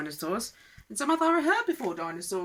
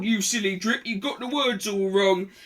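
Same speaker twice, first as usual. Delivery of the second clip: plain reading voice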